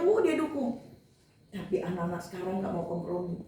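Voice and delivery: usual speaking voice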